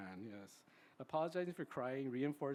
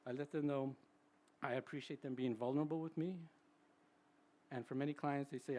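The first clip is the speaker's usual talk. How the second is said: ordinary talk, nothing marked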